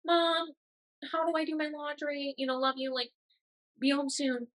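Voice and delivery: put-on high, soft little-kid plea to mom; a lightly voiced student calling home for help; needy college kid. College kid calling mom about laundry, high and soft. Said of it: "kind of like".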